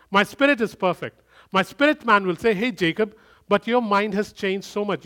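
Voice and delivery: loud, high full-force sermon delivery; fervent preaching. Acted. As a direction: preach it hard to the congregation. Opening of a sermon.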